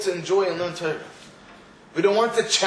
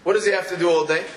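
normal talking voice

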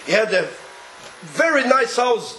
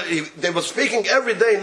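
normal talking voice

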